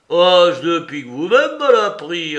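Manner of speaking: « vous-même » lancé fort et aigu; riposte rageuse. Acted. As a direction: renvoie l'insulte avec rage. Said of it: "riposta Soupe, sa rage". (Soupe vexé riposte à Larrier, en colère)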